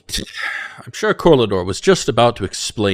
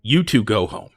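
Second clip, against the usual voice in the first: stern lord voice; 4 semitones higher, 5 dB louder than usual. Acted. Lord mayor orders nobles home.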